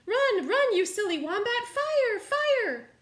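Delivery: high, loud panicked yelling; urgent shout. Acted. Animals yelling at Wombat to flee, loud panicked shout. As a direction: yell at him to run. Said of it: "they all cried".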